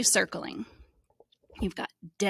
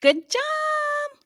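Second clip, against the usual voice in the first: high, sung-out good job; delighted teacher praise; excited praise. Real, not acted. Praising child after reading.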